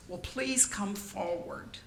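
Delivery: ordinary delivery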